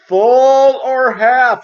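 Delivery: very high, very slow, loud bark; mock yelling. Cashier yelling back at the customer, very slow and loud. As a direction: shout each word slowly. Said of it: "He's, like, yelling back at me".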